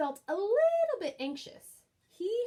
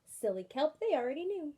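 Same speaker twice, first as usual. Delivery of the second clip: ordinary delivery, nothing marked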